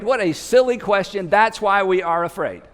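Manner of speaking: usual speaking voice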